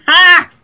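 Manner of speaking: big burst of laughter, a loud "Ha!"; sudden loud belly laugh. Real, not acted. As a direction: crack up with a loud bark of a laugh. Bursts out laughing mid-sermon.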